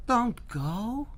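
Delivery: high, pleading call; Herschel pleading. Acted. Herschel begs the goblin to stay, pleading. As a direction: beg him to stay, high. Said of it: "Herschel pleaded".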